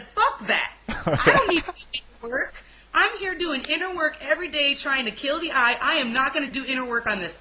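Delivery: protesting with mild defiance; defiant. Retold or imitated. Speaker retelling her refusal of inner work, defiant.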